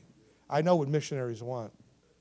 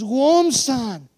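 high, loud exclamation; imitated astonished boy; 10 semitones higher, 13 dB louder than usual. Little boy staring at the two missionaries, astonished exclamation. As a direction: blurt it out in amazement. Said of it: "He's like this".

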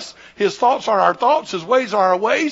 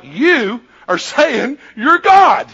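loud, drawn-out slow challenge; incredulous accusation; 3 semitones higher, 5 dB louder, much slower than usual. Retold or imitated. Scribes confront Jesus over his claim, incredulously. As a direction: stretch each word in disbelief.

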